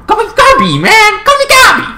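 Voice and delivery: very high goblin squeal; pleading squeal. Acted. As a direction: squeak it as an insistent plea. Acted plea for nickname.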